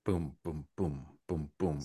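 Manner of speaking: soft, low booms in a rolling beat; rhythmic booms. Shown as a demonstration. Showing a friend how the poem flows, soft rhythmic booms. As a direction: chant a hushed pulse like billows on the sea. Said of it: "the way it flows, almost like billows".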